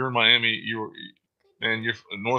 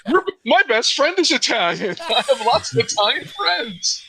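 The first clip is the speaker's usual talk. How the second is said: much higher, louder, laughing through it; laughing mock defense; 11 semitones higher, 5 dB louder than usual. Cohost teasing with laughing high mock excuse. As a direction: squeal the excuse while cracking up.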